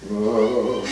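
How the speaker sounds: usual speaking voice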